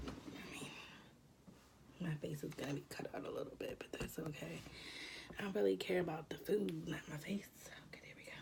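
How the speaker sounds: soft unvoiced ASMR whisper. Real, not acted. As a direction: breathe the words without voice. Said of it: "Little A S M R".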